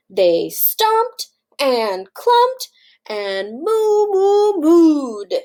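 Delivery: slow chant building to high, loud moos; excited mooing. A narrator's reading. Reader leading children as excited cows, loud mooing. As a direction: lead the children in big drawn-out moos.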